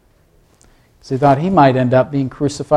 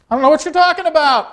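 high, quick, defensive protest; hasty denial; 12 semitones higher, faster than usual. Acted. Peter brushing off the servant girl, hasty denial. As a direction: blurt out a high-pitched denial.

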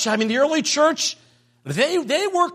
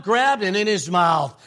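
usual speaking voice

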